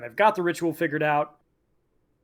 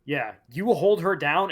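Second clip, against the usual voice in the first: ordinary talk, nothing marked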